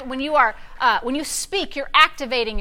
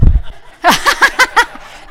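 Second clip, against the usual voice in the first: loud laugh; 10 semitones higher, 5 dB louder than usual. Real, not acted. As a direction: burst of high laughter. Laughing at Seth running.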